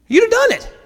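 a comic falsetto jab; mock squeaky emphasis. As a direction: squeak it up to make the point. Servant reasoning with Naaman.

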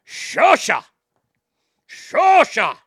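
"shosha" loud, pitch swooping high then dropping; exaggerated inflection. Shown as a demonstration. Host shows off the Japanese word of the day, "shosha" with exaggerated inflection. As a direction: bark "shosha" with a big authoritative bounce. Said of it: "That's inflection right there".

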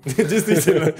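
laughing through the word; a wry laughing reply. Retold or imitated. Retold speaker quip, laughing.

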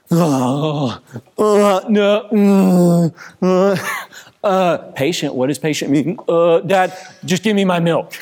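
put-on high, loud stammering child; confused kid voice. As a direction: stall then demand the milk. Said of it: "this is how a conversation ... plays out".

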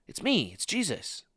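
lightly voiced Jesus, tender and soft; gentle reassurance. Shown as a demonstration. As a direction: say it like a quiet comfort. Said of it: "voicing Jesus gently".

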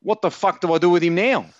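high-pitched, helpless exasperation; exasperated. Real, not acted. Fantasy player asks co-host about his dud pick, exasperated. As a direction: throw up the question in real frustration, pitched high.

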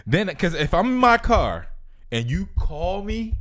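excited rant. Real, not acted. Excited ranting.

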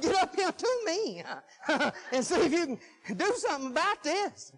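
high plea broken by laughs; amused retold plea. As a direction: laugh through a mock-helpless plea. Retells calling wife while shaking, laughs.

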